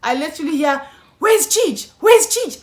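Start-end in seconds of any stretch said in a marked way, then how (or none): 1.2-2.6 high, loud shouted question; mock shout from across the house; imitating noisy shouting. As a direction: call out like rowdy friends bursting in. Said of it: "I just hear noise".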